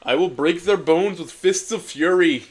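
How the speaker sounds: cartoon cat raging; mock rage. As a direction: vow revenge to the sky. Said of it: "raged Garfield to the cosmos".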